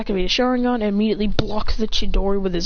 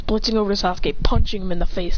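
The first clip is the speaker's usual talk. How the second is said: plain reading voice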